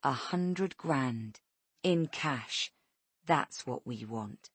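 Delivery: slow, deliberate, each word weighed; calm ransom demand, drawn out; slowly. Acted. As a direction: spell out the demand, unhurried. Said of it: "Rhonda says slowly".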